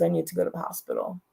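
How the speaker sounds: soft, quick, faint voice of a sick mother; a hint of a frail old woman asking for help; weak mother. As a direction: sound drained and urgent, lightly voiced. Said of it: "she called me and she goes".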